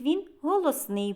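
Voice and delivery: ordinary talk, nothing marked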